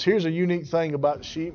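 ordinary talk, nothing marked

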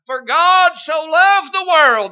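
high, loud, slow proclamation; preacher lifts John three sixteen; proclaimed verse. Real, not acted. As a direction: ring out the verse to the congregation.

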